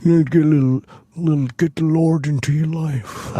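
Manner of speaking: put-on character voice with a Southern accent; Eugene, Southern accent. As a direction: play a Southern character preaching at someone. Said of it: "but with a southern accent".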